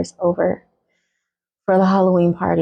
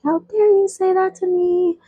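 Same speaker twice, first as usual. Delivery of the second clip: much higher, indignant imitated sister; mock outrage; 12 semitones higher than usual. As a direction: shriek it like the offended sister. Sister hops up, offended.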